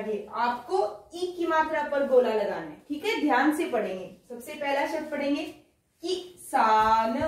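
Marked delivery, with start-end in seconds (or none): 6.0-7.2 drawn out slowly, each sound apart; slow syllable-by-syllable reading. Shown as a demonstration. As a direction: spell it out slowly for the class. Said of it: "किसान है ना इ की मात्रा का".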